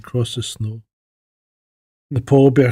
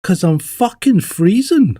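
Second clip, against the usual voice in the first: slower, a bit louder punchline; slower, higher cub voice delivering the punchline; 6 semitones higher, 4 dB louder, slower than usual. Retold or imitated. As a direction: land the joke with a grumble. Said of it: "the cub goes".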